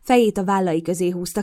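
ordinary delivery

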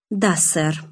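plain reading voice

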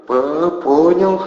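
high, slow, laboured stutter; strained stammer. A narrator's reading. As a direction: squeeze the word out with difficulty. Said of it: "с трудом выдавил армеец". (заикаясь, с трудом, высоко)

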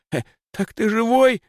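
gasping in disbelief; astonished. Acted. Boy sees Lis alive.

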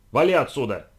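loud harsh bark. Acted. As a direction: bark the order at her. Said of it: "рявкнул он".

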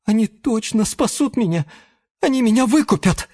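hopeful insistence. Acted. Chameleon hopes desperately.

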